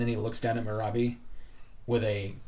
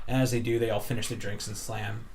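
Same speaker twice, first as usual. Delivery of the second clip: ordinary delivery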